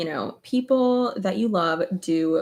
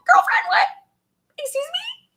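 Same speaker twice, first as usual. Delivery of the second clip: shocked disbelief; 4 dB louder than usual. Retold or imitated. Friends hear shocking girlfriend news.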